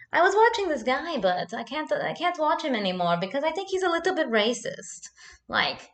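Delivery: high-pitched husband impression with German accent; Basti, German accent. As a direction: confess earnestly in a mock German accent. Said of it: "he came to me and he's like".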